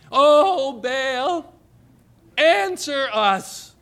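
high, loud, drawn-out plea to an idol; crying out to Baal. Retold or imitated. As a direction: call out like a desperate prophet of Baal. Prophets of Baal calling on Baal.

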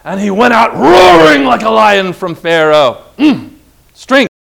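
loud, driving proclamation; roaring preaching. Real, not acted. Preacher proclaims Moses roaring from Pharaoh, loud. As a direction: roar it out like a lion.

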